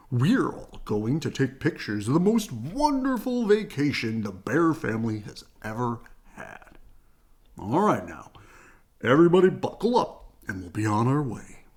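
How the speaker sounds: hearty, booming father bear rallying his family; jolly Papa Bear. A narrator's reading. Papa buckling the family in, hearty and loud. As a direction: cheer everyone into the car. Papa's voice in story.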